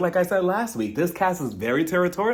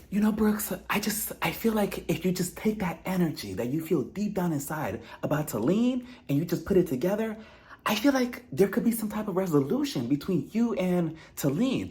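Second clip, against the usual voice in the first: a soft, higher, breathy-sounding mock guru voice; mock preachy Sarah; 4 semitones higher, 4 dB quieter than usual. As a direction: lightly imitate a sanctimonious life coach. Sarah's preachy speech voiced mockingly.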